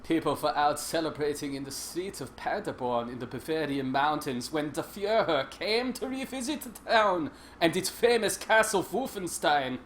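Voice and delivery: lightly put-on bad German accent reading the narration; terrible German accent. Streamer reads the game's intro in a mild mock German accent. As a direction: read it like a hammy German narrator, not quite committed. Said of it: "It's a terrible accent".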